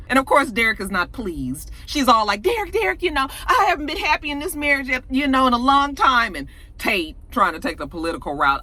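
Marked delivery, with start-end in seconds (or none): 2.4-6.4 high-pitched, hurried pleading; mock frantic excuse, pitch well up. Retelling caught wife explaining to Derek, pleading.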